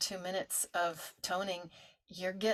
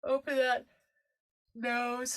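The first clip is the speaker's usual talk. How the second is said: yawning; 6 semitones higher, 6 dB louder, slower than usual. Shown as a demonstration.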